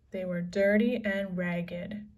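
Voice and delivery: ordinary delivery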